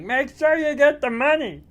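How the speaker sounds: high, loud cartoonish robber voice; robber's order. Second robber urging on his partner, high loud order.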